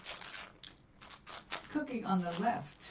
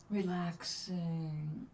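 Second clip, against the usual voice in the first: slow relaxed drawl; 2 semitones lower, much slower than usual. Real, not acted. Savouring peppermint hot chocolate.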